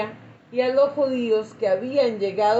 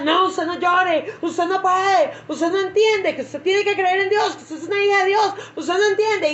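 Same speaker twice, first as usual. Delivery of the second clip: scolding comforter; 6 semitones higher than usual. Retold or imitated. Preacher imitates a tactless counselor scolding a crying woman, raised, impatient voice. Mocking a harsh comforter at a funeral.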